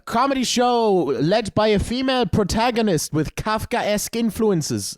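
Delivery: high, slow mock-theatrical delivery; affected flyerer pitch. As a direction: mimic a pretentious show promoter, drawing it out. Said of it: "Can you talk like a normal person?".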